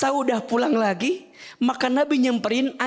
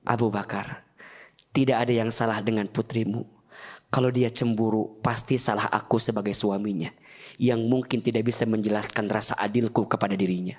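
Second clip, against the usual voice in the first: gentle reassurance; 11 semitones lower than usual. Acted. Prophet calmly defends his wife.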